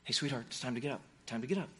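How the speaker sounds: low, very soft and tender; hushed loving wake-up call. Retold or imitated. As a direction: gently rouse a sleeping child. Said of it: "parents would do on a sunny day".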